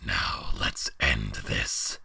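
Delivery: very low, slow monster voice; deep slow beast voice. Acted. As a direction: scold like a disappointed giant. Beast challenges.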